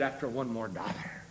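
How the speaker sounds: very low and soft; hushed reply. Retold or imitated. Preacher voicing dying Rockefeller's answer, hushed. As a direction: answer quietly, barely raising the voice.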